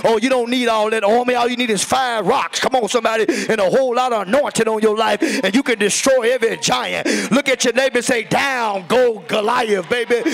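loud, fired-up sermon climax; fervent revival preacher driving the message home; full-force preaching. Real, not acted. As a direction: preach it with rising fire, stretching out the battle cry.

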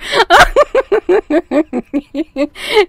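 high helpless laugh. Real, not acted. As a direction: collapse into laughter. Reader cracks up.

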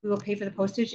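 ordinary delivery

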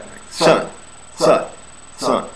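teacher modelling the short u sound; punched short u. Shown as a demonstration. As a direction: hit the u in sun like a gut punch. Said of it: "Like you're getting punched in the gut".

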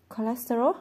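baffled echo with a rising tune; puzzled echo. Acted. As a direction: repeat the word as if it's brand new to you. Toby echoes the word, puzzled.

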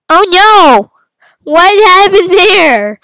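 loud, high alarm, slowing down; surprised shout. Real, not acted. Player falling backwards, loud surprised shout. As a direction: cry out at the sudden fall.

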